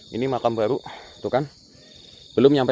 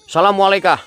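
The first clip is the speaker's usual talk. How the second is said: high, loud greeting call; 7 semitones higher, 6 dB louder, faster than usual. Real, not acted. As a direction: hail an unseen presence loudly. Calls greeting to unseen spirit. (salam lantang)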